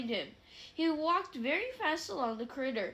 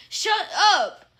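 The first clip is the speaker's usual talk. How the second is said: furious yell; 6 semitones higher, 13 dB louder than usual. A narrator's reading. Harry yells at Peeves.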